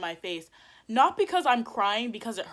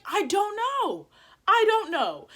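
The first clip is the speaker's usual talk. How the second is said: high, loud, drawn-out sarcasm; acted-out indignant retort; retold exasperation; 11 semitones higher, 6 dB louder, slower than usual. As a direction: throw it out in exasperation.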